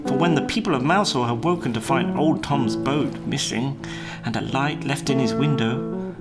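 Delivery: plain reading voice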